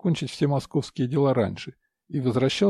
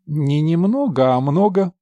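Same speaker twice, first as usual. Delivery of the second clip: slow, coy and louder; affected voice; 3 semitones higher, 6 dB louder than usual. Acted. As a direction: purr it out, mannered and coy. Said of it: "услышал он жеманный голос в ответ".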